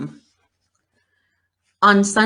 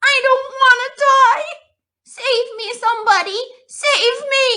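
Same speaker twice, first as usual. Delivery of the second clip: high, loud frantic begging; terrified piglet wailing for help; panicked pleading; 5 dB louder than usual. A narrator's reading. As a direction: cry out for rescue in terror. Panicked pig begging for life.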